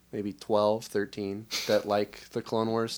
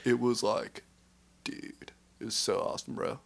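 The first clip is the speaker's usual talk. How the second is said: bro voice; 4 dB quieter than usual. Acted. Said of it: "That's, that's the bro voice".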